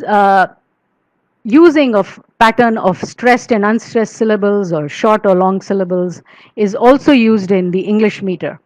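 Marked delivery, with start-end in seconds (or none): none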